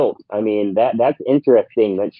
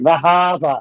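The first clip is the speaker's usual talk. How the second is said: mock Boston accent; 3 semitones higher, slower than usual. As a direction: parody a bad Boston accent. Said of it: "they don't sound like that".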